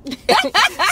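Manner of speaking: a loud, high burst of laughter; excited high laugh. Real, not acted. Man laughing while retelling how he found the bourbon, loud and high.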